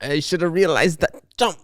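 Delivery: hammed-up politician building to CHINA; Trump. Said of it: "I've been doing my Trump impression".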